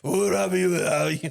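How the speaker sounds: loud raspy rapper croon. Shown as a demonstration.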